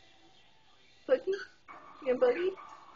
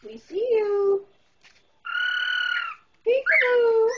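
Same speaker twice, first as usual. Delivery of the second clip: put-on high sing-song baby voice to a parrot; pet baby talk; 14 semitones higher than usual. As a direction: coax the bird like talking to a toddler. Calling to the parrot.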